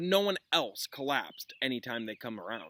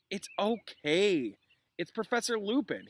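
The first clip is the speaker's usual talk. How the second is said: lightly voiced Hermione; 8 semitones higher than usual. Student peeks into classroom.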